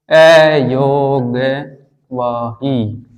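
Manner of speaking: अयोगवाही drawn out syllable by syllable, very slow; slow sounding-out. Shown as a demonstration. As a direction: stretch each syllable for the class. Term spelt out slowly for class. (एक-एक अक्षर खींचकर, बहुत धीरे)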